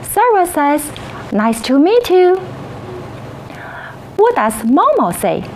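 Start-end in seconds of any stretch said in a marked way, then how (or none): none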